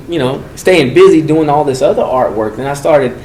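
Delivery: usual speaking voice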